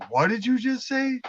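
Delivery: mildly acted, high-pitched challenge; indignant retort. Woman challenging her man in a retold skit, mock indignant.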